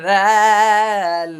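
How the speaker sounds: দাল stretched very long and loud; drawn-out loud দাল; held letter demo. Shown as a demonstration. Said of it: "সাটা বাতাস বের করবেন".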